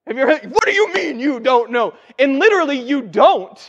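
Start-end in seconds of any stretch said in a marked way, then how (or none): 0.5-1.9 exasperated parent. Acted. Parents asking a child for reasons, exasperated scolding.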